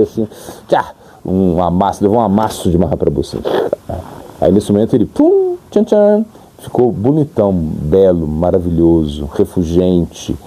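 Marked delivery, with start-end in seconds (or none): 5.1-6.2 sound effect. Acted. Sudden transformation sound.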